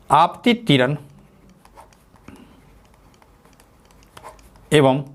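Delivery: word by word dictation with long pauses. Shown as a demonstration. Teacher dictating the angle of incidence for notes, slowly. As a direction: spell it out slowly, one chunk at a time. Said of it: "लिख लीजिए".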